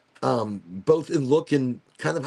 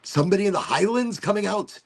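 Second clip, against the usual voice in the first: put-on higher-pitched playful Scottish accent; 6 semitones higher, 3 dB louder than usual. Streamer joking about the Highlands, light Scottish accent. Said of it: "a little bit of Scottish".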